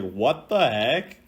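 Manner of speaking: ordinary delivery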